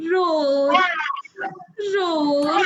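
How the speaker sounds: a sad lion's roar; teacher voicing a sad lion for the quiz. Shown as a demonstration. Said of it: "and he roars like this".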